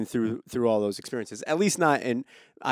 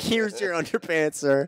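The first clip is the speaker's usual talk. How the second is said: higher, prim and polite; mock polite high voice; 6 semitones higher than usual. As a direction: play it prim and proper. Retold awkward handover.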